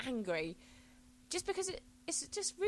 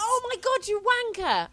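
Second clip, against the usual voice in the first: high, loud exasperated cry; mock furious outburst; 14 semitones higher, 8 dB louder, slower than usual. Reacting to the bus driver's late news, loud high outrage. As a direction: explode at the driver in disbelief.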